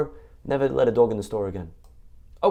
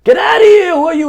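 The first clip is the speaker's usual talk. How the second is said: much higher, loud furious shout; angry yell; 12 dB louder than usual. Acted. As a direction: yell at the heckler to get lost. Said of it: "he's yelling at this nobody".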